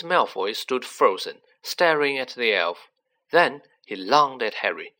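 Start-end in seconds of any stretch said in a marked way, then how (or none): none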